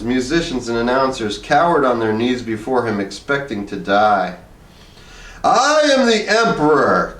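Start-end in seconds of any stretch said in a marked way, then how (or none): 5.4-7.1 higher, slow, proud cry; cried out. A narrator's reading. Harrison proclaims himself emperor to the studio, crying out higher. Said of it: "cried Harrison".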